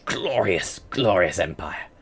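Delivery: lightly hammed-up despot; mock grandiose. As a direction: savour each glorious in a hushed, lordly voice. Grandiose dream of empire.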